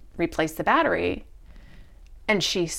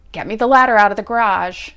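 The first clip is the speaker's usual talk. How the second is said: loud, fast order; urgent command; 8 dB louder, faster than usual. Retold or imitated. To her wife, demanding the ladder, loud and fast. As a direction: bark it out quickly. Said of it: "And so I'm like".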